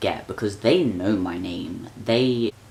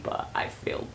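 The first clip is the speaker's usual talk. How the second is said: whispered aside; 14 semitones lower, 10 dB quieter than usual. Real, not acted. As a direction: whisper it softly as a secret. Hushed aside.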